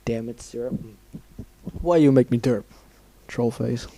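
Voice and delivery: talking through a burp; mid-burp, talking quietly. Real, not acted. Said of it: "I'm burping".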